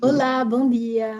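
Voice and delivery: slow, loud greeting aimed at the microphone; slow dictation greeting. Shown as a demonstration. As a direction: greet the mic slowly and loudly, word by word. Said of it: "C'est la langue".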